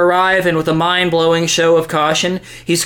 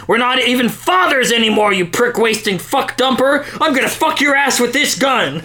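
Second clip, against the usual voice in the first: angry; 7 semitones higher than usual. Acted. Enraged ranting threat.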